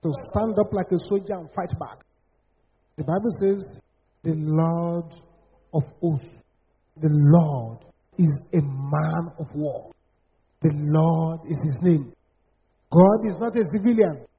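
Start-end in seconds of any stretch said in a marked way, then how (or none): 4.2-12.1 low, slow, weighty proclamation; solemn scripture quote. Real, not acted. Preacher quoting the Bible on God, solemn declaration. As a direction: intone each phrase slowly and low.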